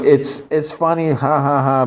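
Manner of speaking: high singsong fake laugh; token amusement. Real, not acted. Reviewer grants that the banter is funny, mock amused. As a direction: pitch it up with hollow ha-has.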